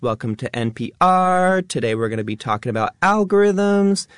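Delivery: high, rising lifestyle influencer accent; chirpy YouTube intro with every line lifting up; influencer up talk. Shown as a demonstration. Demoing an influencer opening for the radio audience, high rising up talk. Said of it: "It has that up talk".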